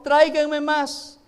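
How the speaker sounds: slow loud call. Acted. Mother asks sons for more jars.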